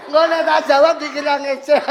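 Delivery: phone owner answering in the same nasal speech; nasal speech imitation. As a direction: answer with blocked-nose speech. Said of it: "jawabnya gimana".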